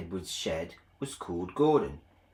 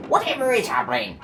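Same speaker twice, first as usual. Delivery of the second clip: startled trucks; 10 semitones higher, 6 dB louder, faster than usual. Acted.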